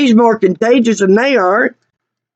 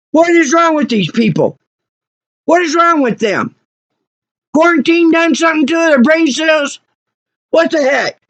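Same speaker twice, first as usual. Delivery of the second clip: higher-pitched indignant rant; exasperated; 6 semitones higher than usual. Real, not acted. Customer ganged up on by garage workers, exasperated. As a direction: vent in disbelief at their behavior. Ranting about being ganged up on.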